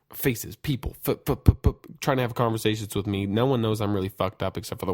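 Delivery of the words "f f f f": quick puffed bursts of f sounds; imitated flashes. Man retelling how everything came in flashes, imitated whooshing puffs. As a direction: puff out a rapid string of f's. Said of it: "everything's flashes".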